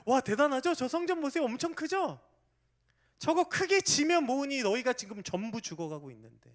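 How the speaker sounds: loud, high-pitched awe; people marveling loudly. Retold or imitated. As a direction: gush over the huge temple, loudly. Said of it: "이 백성들은 막". (성전을 보며 감탄하는 백성들, 높고 큰 소리로 감탄)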